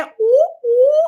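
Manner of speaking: high, loud excited coo; delighted ooh. Real, not acted. Host reacting to a viewer's birthday wish, high excited squeal.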